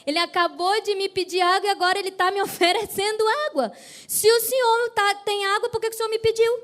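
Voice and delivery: high-pitched, incredulous protest; amused imitation of an indignant woman; woman's disbelief. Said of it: "a mulher deve ter pensado assim".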